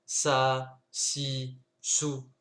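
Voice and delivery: ça, si, sou: s suivi de a, i, ou; wrong vowel takes. Shown as a demonstration. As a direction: montrer les voyelles à éviter. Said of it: "on cherche pas à dire". (prononciation avec voyelles, à ne pas faire)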